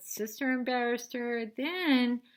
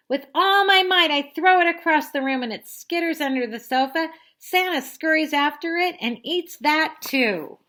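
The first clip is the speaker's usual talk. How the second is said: loud, high excited telling; storyteller hits the climax loudly; dramatic narration; 8 semitones higher, 11 dB louder than usual. A narrator's reading. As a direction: build it up big.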